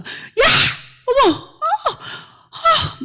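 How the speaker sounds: very high shocked cries of pain; startled shriek. Retold or imitated. Kindergarten teacher struck by slingshot, shrieking in pain. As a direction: yelp as if just hit in the face. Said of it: "막 이렇게 합니다".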